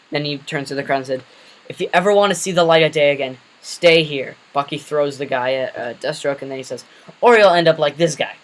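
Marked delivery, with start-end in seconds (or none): 1.7-4.4 loud threat. Acted. Deathstroke threatens crowd. 7.2-8.4 loud threat. Acted. Deathstroke threatens crowd.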